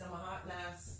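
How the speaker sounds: trailing off. Real, not acted.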